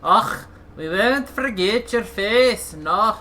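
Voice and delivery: put-on Scottish accent. Gamer echoing the game's beggar in a Scottish accent. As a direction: ham up a Scottish beggar's thanks, drawing it out. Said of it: "we'd have an accent like this".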